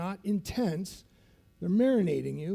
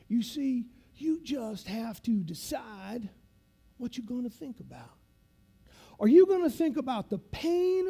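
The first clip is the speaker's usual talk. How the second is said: put-on dole out the secret like an old military instructor, pitch often raised; instructor's voice; 4 semitones higher than usual. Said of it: "he talks like this".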